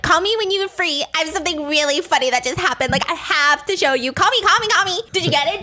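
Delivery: excited voice message. Retold or imitated. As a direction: gush into the phone to a friend. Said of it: "sending voice messages ... so excited".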